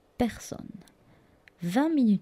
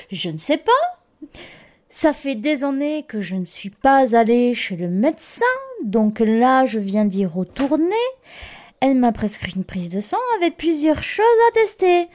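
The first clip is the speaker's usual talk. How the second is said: flustered; 5 semitones higher, 6 dB louder than usual. Retold or imitated. Flustered patient repeating herself.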